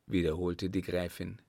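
ordinary delivery

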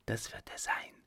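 tonlos, sehr leise gehaucht; geflüstert. A narrator's reading. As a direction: aufgeregt ins Dunkel flüstern. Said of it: "flüsterte der Letztere". (flüsternd, ohne Stimme)

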